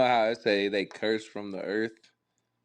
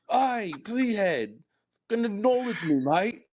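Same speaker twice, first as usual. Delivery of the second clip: mock Australian; 10 semitones higher than usual. Mocking the imagined Aussie man, high Australian accent. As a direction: drag out "Aye" in a silly Australian twang.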